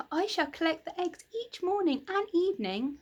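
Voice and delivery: bright with excitement about the hens; happy child. Acted. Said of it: "said Franny happily".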